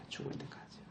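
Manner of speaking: a voiceless whisper, barely there; whispered echo. Real, not acted. Preacher hushing the congregation, whispered echo. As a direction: breathe it out as a hushed afterthought.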